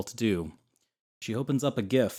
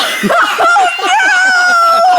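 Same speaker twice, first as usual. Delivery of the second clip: hysterical laughter; 16 dB louder than usual. Real, not acted. Hysterical laughter at car story.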